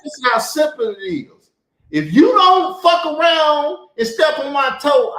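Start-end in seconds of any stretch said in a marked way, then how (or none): 1.9-5.1 angry warning. Real, not acted.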